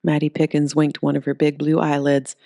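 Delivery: usual speaking voice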